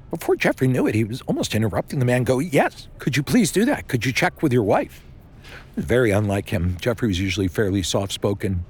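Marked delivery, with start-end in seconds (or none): 2.4-5.0 urgent, higher-pitched demand; pressing plea with raised pitch; harsh, insistent. A narrator's reading. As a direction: cut in eagerly, pushing hard for an answer. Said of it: "how harsh his tone was".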